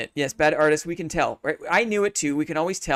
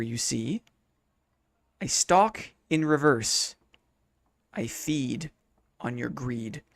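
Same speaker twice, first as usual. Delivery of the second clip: slow, soft, creeping riddle read; quiet riddle reading; 4 semitones lower, 4 dB quieter, slower than usual. Acted. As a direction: read it like a lurking creature. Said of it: "We'll talk nice and quietly".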